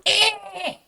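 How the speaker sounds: very high screech that dissolves into laughter; better creature screech. Shown as a demonstration. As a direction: redo the creature's screech with a giggle. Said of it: "Actually, I could do that better".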